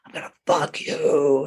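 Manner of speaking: low, menacing mock ghost whisper-voice; a low, imitated threatening ghost; creepy ghost voice. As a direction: voice the lurking spirit, low and sinister. Ghost voice retold from recording.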